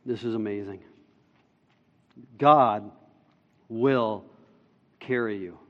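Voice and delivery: low, soft pastoral exhortation; tender preaching. Real, not acted. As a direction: speak gently to the congregation, with long pauses.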